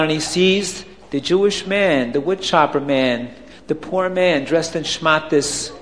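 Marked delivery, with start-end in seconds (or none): none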